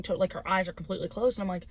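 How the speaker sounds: ordinary talk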